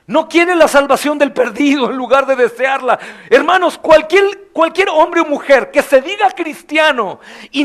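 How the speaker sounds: high, loud passionate sermon; loud, high fervent pulpit preaching; fiery preaching. Acted. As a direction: preach it hard to the congregation. Preaching at full force.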